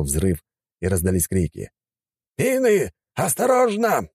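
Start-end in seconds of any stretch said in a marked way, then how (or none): 2.4-4.1 high urgent shouted warning. A narrator's reading. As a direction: yell the danger to the troops. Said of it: "прокричал командир". (крик предупреждения)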